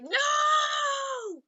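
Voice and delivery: very high, sustained "no"; horrified scream. Acted. Deku watching helplessly, horrified scream. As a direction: wail it long and high.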